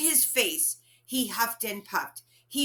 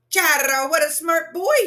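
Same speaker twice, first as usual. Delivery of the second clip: proud cheer; 8 semitones higher, 9 dB louder than usual. Acted. Proud father praises son.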